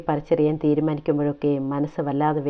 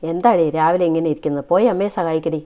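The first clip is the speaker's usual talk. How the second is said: louder, brotherly scolding; teasing scold; 3 semitones higher, 8 dB louder than usual. A narrator's reading. Brother tells his sulking sister off, loud teasing scold. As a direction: bark at her to get up and help.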